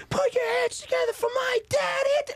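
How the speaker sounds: put-on very high shouted kid announcer; hype introduction. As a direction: imitate a little girl whipping up applause. Daughter introduces dad on stage.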